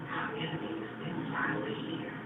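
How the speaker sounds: very soft, low and confiding; hushed reveal. Real, not acted. Preacher revealing the enemy within to the audience, hushed. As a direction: drop to a near-whisper. Hushed punchline after raised contrast.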